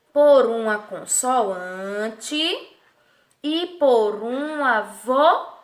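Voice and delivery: very slow, "consoante" stretched, "vogal" split as vo-gal; drawn-out answer. Shown as a demonstration. Teacher answering who forms the syllable, "consoante" and "vogal" drawn out. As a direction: hold "consoante", then break "vogal" in two.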